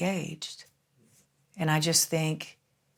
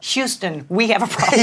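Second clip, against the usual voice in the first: dramatic movie quote; 5 semitones higher, 10 dB louder than usual. Retold or imitated. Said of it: "famous movie quote".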